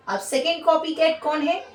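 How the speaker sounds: ordinary delivery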